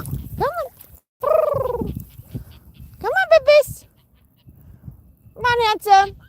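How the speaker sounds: high sing-song call; calling pets. Real, not acted. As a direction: coax them in a high voice.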